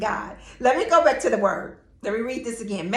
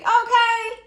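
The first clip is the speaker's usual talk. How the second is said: high laughing okay; 10 semitones higher than usual. Retold or imitated. Laughing mock defiance in retold quote.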